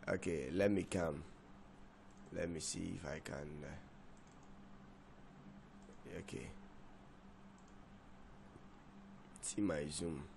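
very soft, low self-talk; hushed, distracted aside to oneself. Real, not acted. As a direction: drop right down, as if busy checking something. Fiddling with screen, talking to self.

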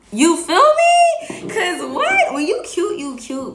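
high-pitched, laughing excitement; playful hype. Real, not acted. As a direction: hype yourself up, voice shooting high. Playfully hyping herself up.

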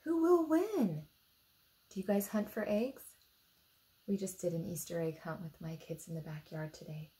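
ordinary delivery

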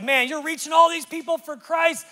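high, loud exasperated complaint; hammed-up wail of an overlooked mom; discouraged wife. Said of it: "and she felt bad".

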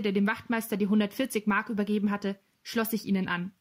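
ordinary delivery, nothing marked

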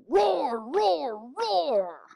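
loud, high playful roars; bear roaring. Acted. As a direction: roar like a storybook bear.

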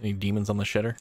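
normal talking voice

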